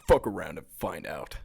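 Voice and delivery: low menacing biker voice; devious biker voice. Retold or imitated. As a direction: growl it like a tough biker. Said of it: "They're like".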